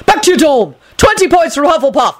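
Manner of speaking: high, stern teacher's shout; shouted order. A narrator's reading. Neville sending girls to dorm, shouting. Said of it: "Neville shouted".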